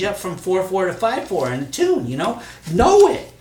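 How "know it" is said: high, loud insistence; loud teacherly push; emphatic command. Real, not acted. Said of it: "urging young poets, emphatic".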